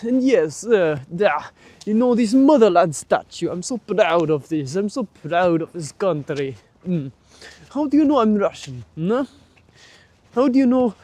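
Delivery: put-on proud comrade in a thick Russian accent, teasing; mock Soviet accent. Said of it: "that was my Soviet accent".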